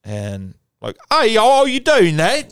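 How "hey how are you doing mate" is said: very high, loud British greeting; hammed-up, piping British hello; Mary Poppins British accent. Shown as a demonstration. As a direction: call out in a cartoonish British accent. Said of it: "like, Mary Poppins kinda".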